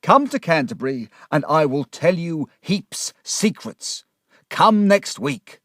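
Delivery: loud, slow, high-pitched outburst of hatred; agitated shout. Acted. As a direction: shout it, then urge them desperately. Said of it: "he shouted".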